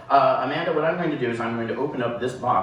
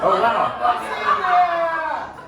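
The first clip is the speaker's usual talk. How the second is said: mock horror. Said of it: "my worst Brad Pitt impression".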